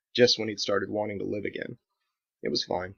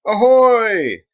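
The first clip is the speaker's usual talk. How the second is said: loud high hail across the water; ship's hail, much higher and louder; calling out; 13 semitones higher, 14 dB louder than usual. Acted. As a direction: call it out to be heard far off. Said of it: "called out".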